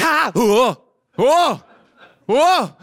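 loud, high hammed-up startled whoa. Preacher playing Sarah feeling her body change, a loud startled whoa. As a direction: yelp it out like sudden shock. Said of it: "She's like".

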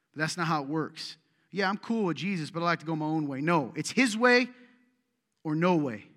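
loud, driving sermon delivery; forceful preaching. Acted. Pastor preaching loudly to his church, forceful. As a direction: preach it hard to the congregation.

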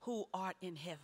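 lightly imitated praying pastor, low and measured; a hint of a solemn pastor at prayer; pastor's prayer. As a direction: recite a solemn prayer with pauses, voice dropped. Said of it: "and he would say".

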